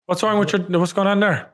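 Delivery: ordinary talk, nothing marked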